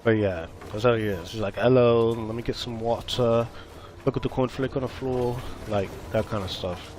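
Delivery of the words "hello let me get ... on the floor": mock British accent. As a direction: imitate how a British friend talks. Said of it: "like a British person".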